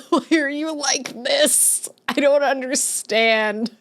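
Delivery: high, drawn-out hammed-up lament; mock anguished wail. Streamer to chat over snapped spaghetti, mock anguished wail. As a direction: wail in pretend despair.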